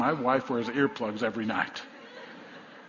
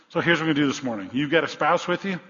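ordinary delivery

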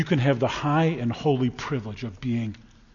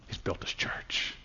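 breathy awed whisper; 15 dB quieter, slower than usual. Acted. Preacher to congregation, a hushed awed whisper. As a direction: hush it like a secret.

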